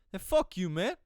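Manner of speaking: high, loud mocking retort; mock defiant outburst. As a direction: hurl it back, imitating an angry guy. Retells defiant man cursing him.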